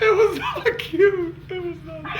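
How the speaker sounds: high, slow, laughing so hard it nearly turns to crying; can barely get it out for laughing. Real, not acted. Confessing to friends, laughing till near tears.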